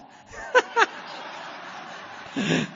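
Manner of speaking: long breathy laugh at himself; hearty self-mocking laugh; big laugh. Real, not acted.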